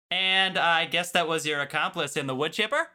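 Minnesota lilt rising at the end; Minnesota accent. Acted. Host quoting a favorite Fargo line, Minnesota accent. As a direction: put on a Minnesota accent, ending up like a question. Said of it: "our Minnesota accents here".

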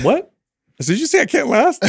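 mock indignant what; hearing a steam room insult in disbelief; retold disbelief.

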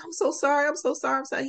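put-on a scared child rushing to apologise; frightened apology.

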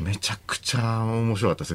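ordinary delivery

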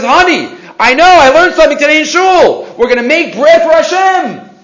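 high, loud acted excitement; imitated eager husband; excited announcement. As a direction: burst in with great news for the wife. Husband excitedly tells his wife.

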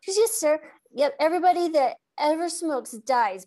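lightly voiced child begging her grandpa; pleading granddaughter. Retelling granddaughter begging grandpa to stop, lightly voiced pleading child.

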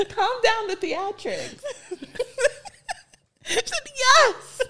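high, laughing through the words; giggling at the drama. Real, not acted. Laughing at theatrics.

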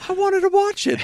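very high-pitched pouty protest; mock whine. Podcaster feigning upset at Highlander spoilers, high mock whine. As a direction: squeal it like a cheated child.

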